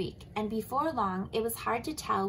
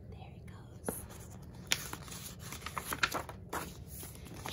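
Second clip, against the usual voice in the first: soft dramatic whisper; 10 dB quieter, much slower than usual. A narrator's reading. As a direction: hush the ending like a secret. Narrating the splash.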